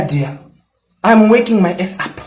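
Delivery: ordinary talk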